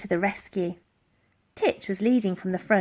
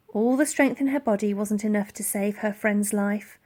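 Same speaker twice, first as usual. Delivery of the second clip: ordinary delivery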